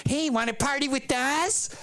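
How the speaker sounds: high, eerie crypt keeper cackle-voice; skeleton voice. Retold or imitated. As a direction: creep it out like a ghoulish host. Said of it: "sounded just like that skeleton crypt keeper".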